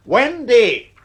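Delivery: loud, high call across the house; shouting her name. Acted. Father calling after the girl running upstairs, loud shout. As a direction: yell for her to stop.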